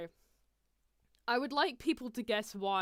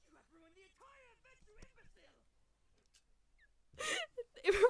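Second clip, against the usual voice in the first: much higher, distant furious cry; Riddle rage; 14 semitones higher, faster than usual. Retold or imitated. Riddle raging at Ace for ruining everything, shrill fury. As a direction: shriek the insult like a cartoon villain. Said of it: "Riddle just straight up said".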